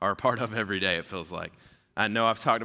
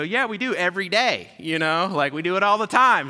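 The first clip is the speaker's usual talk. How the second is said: higher, louder cheerful grandparent voice; eager grandparents; 9 semitones higher, 9 dB louder than usual. Retold or imitated. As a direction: play the clueless proud grandparents. Grandparents voiced in cartoon retelling.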